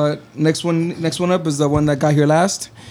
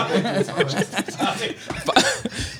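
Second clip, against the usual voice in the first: stifled giggles; 4 semitones higher, 5 dB quieter than usual. Real, not acted. Laughing at own intro.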